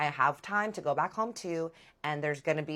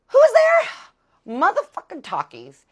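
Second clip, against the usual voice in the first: much higher and louder, stunned; shocked reveal; 15 dB louder than usual. Real, not acted. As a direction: shriek out the reveal in disbelief. Dramatic reveal of the ex.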